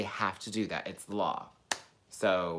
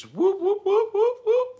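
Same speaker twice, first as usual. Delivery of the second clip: very high siren mimicry; imitated police siren whoops; 7 dB louder, slower than usual. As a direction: break into loud mock siren whoops.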